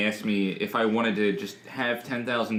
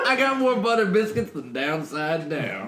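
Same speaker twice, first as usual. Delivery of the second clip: slow laughing Southern drawl; Southern lawyer drawl; 6 semitones higher than usual. Pretend. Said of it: "like a Southern lawyer".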